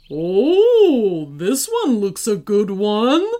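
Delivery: loud booming giant; big giant voice, pleased. A narrator's reading.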